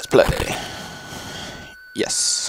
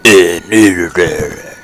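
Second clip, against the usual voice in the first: mock Duke voice; 2 semitones lower, 5 dB louder, much slower than usual. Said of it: "I don't know why I did the "uh".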